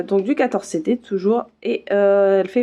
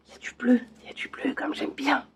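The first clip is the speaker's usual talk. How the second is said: hushed, whispered aside; soft, higher-lifted whisper of delight; 5 semitones higher, 8 dB quieter than usual. Real, not acted. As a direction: whisper it like a little secret treat. Delighted aside on seeing blue thread.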